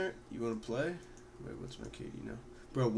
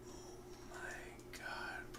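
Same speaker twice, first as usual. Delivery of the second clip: slow, disbelieving whisper; 14 dB quieter, much slower than usual. Real, not acted. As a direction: breathe it out in dismay. K/D dropped.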